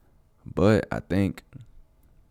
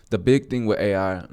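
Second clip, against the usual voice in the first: talking while chewing gum; words round a wad of gum; 7 semitones higher, 9 dB louder than usual. Real, not acted. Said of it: "I'm sorry, I'm chewing gum".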